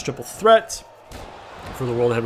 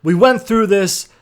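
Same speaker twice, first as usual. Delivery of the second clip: higher, louder exasperated scolding; frustrated manager yelling at Umaga; upset rant; 5 semitones higher, 5 dB louder than usual. Retold or imitated. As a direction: chew out his bodyguard for blowing it. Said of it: "would get on the mic very upset".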